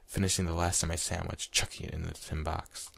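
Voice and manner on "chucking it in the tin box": ordinary delivery, nothing marked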